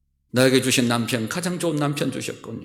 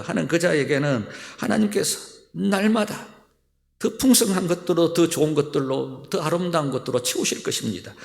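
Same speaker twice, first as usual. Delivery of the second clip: usual speaking voice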